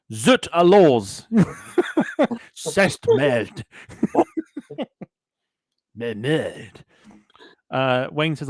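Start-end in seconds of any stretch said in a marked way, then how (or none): 0.1-1.3 slow, drawn-out hammed-up French, louder and higher; mock French accent. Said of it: "I'm gonna put". 2.5-3.6 mock French. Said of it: "I'm gonna put". 6.0-6.8 mock French. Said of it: "I'm gonna put".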